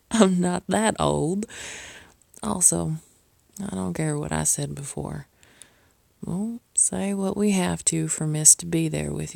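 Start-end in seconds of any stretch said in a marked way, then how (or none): none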